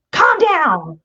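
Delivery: high-pitched mock order to calm; sharp command. Parent opposing a worked-up kid, sharp and high. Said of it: "if you go completely opposite".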